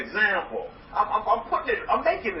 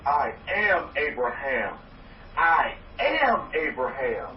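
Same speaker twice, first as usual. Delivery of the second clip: slow, deliberate chant of I am Abraham; slow affirmation; 5 semitones lower, slower than usual. Shown as a demonstration. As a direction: lean on am, drawn out like a creed. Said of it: "Say that".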